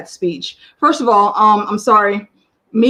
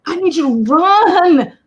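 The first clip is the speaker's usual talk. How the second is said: higher, pleading push to run; urgent plea; 7 semitones higher, 4 dB louder than usual. Retold or imitated. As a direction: beg her to hurry. Urging the character to run.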